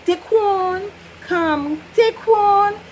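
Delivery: mock call across the yard; calling a child. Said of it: "Say for child name".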